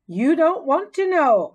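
high, loud little squeak, dragging out want to; a hamster hinting it's a sore subject; squeaky and wry; squeaky warning. A narrator's reading. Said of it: "I squeaked".

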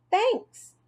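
put-on light, high kid voice; high child voice. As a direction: play a young kid, bright and high. Child character voice.

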